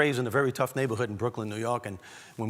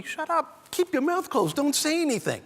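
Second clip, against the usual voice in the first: much higher, urgent brother voice; warning brothers; 14 semitones higher than usual. Retold or imitated. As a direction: plead like brothers heading off trouble. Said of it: "My brothers would always tell me".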